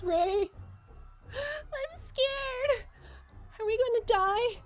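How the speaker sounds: put-on frightened child crying out to Ray; scared child. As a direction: plead like a terrified kid.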